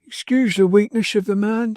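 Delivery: put-on high, loud indignant old nurse; indignant old nurse, lightly voiced; Mrs Gamp indignant. A narrator's reading. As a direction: apologise with scorn for the man. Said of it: "said Missus Gamp".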